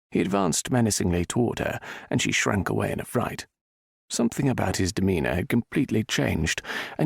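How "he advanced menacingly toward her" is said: usual speaking voice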